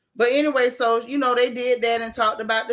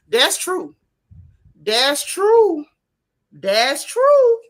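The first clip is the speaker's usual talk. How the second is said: "That's true" higher and louder each time, TRUE drawn up high; building emphatic agreement; 5 semitones higher, 7 dB louder, much slower than usual. Real, not acted. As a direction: agree harder with each repeat, climbing to a loud, high TRUE. Emphatic repeated agreement with a commenter.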